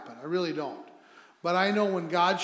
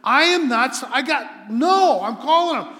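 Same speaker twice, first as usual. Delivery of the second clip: loud, higher, emphatic declaration; determined resolve; 5 semitones higher, 8 dB louder than usual. Retold or imitated. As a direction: voice his resolve forcefully, imitating the caller. Caller arguing with himself, acted.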